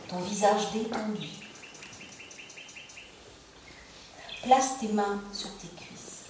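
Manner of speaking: calm yoga nidra guidance with long pauses; unhurried meditation voice, pausing between phrases; guided relaxation. Acted. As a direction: guide them gently into rest, phrase by phrase.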